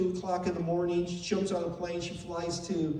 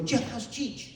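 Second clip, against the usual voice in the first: lightly voiced eager friend, pitched up; imitated eager friend; 4 semitones higher, 3 dB quieter than usual. Imitating his friend asking after the granddaughter, lightly eager. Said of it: "and he'd go".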